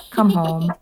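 plain reading voice

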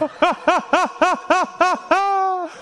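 high-pitched mock laughing in bursts; imitated laughter. Comedian mimicking other people, high mock laugh. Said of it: "That's like people who are like".

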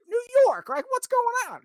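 put-on Boston accent, pitched high and shouted. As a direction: ham up a Boston guy's greeting. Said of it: "a better Mister Boston impression".

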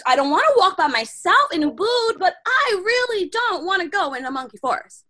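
loud, high-pitched reluctant whine; torn, protesting. Retold or imitated. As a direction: act out a pleading refusal to yourself. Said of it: "wrestling. I'm like".

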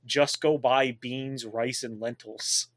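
slow and spelled out, each item weighed; patient, slowed-down explaining; slow, deliberate advice. Retold or imitated. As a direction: lay it out slowly like it's obvious. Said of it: "I'm like".

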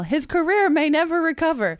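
high, loud laughing jeer; mock yell. Heckling Idris Elba in the theater, high laughing yell. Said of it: "you can yell".

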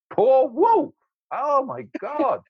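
high, exclaimed echo in pretend shock; hammed-up incredulous outburst; mock disbelief. Father challenged on pitying the wolf, mock disbelief.

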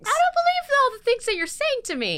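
high, sing-song sarcastic quote; mock inner skeptic. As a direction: voice the unspoken doubt in a mock tone. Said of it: "you're like".

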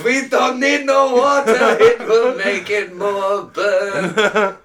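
high, loud, slow Russian heel; broken-English barbarian voice. Pretend. Soviet wrestler about fire.

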